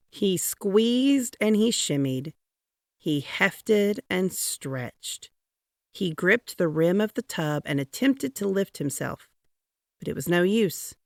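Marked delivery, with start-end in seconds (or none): none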